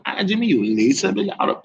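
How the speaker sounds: ordinary delivery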